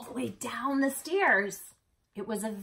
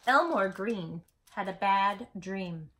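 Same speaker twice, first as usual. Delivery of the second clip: ordinary delivery, nothing marked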